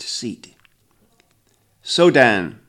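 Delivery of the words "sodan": loud, solemn elder calling a name; grandfather intoning. A narrator's reading. Elder summoning the accused, loud and solemn. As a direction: intone the name like a stern judge. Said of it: "the grandfather intones".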